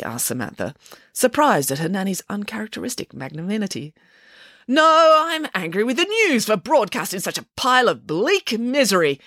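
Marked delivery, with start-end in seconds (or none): 4.7-9.2 angry declaration. A narrator's reading. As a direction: declare your anger, pitched up. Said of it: "declared Nanny Piggins".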